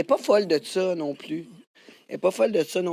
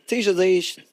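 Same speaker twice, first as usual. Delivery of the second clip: usual speaking voice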